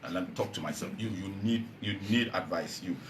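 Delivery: low, soft private talking-to; re-enacted quiet word to oneself; mirror self-talk. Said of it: "sit down in front of my mirror and say".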